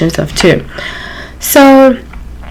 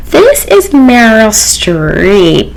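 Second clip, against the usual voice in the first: drawn-out, condescending grand star; Meryl Streep; 3 semitones higher, much slower than usual. Retold or imitated. Star phoning the restaurant about her reservation, condescending. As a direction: announce yourself very slowly, with haughty self-importance.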